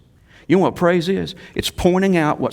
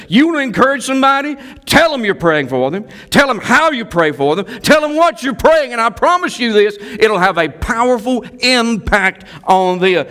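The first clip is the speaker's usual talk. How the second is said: high, loud sermon exhortation; preaching at full force; 10 semitones higher, 5 dB louder than usual. Real, not acted. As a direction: drive the point home to the congregation.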